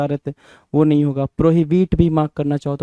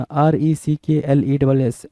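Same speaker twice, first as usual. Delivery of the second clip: spelled letter by letter; much slower than usual. Shown as a demonstration. Teacher spelling out a word for students, slow and letter by letter. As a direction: slowly spell out each letter. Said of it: "reckless होना".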